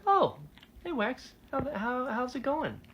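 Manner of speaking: high-pitched, acted-out surprise; awkward phone greeting. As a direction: squeak out a caught-off-guard hello.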